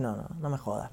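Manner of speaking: barely voiced disbelief, imitating a friend; friend's hushed disbelief. Friend hears of star's message, hushed disbelief. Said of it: "Ibai, preocupado".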